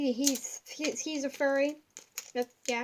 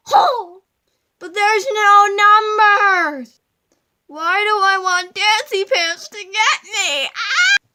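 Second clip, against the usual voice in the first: high, very loud hammed-up panic; mock scared screaming; 7 semitones higher, 16 dB louder, slower than usual. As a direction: scream like a jump-scared gamer. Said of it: "my impression of Markiplier".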